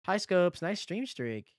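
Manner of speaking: normal talking voice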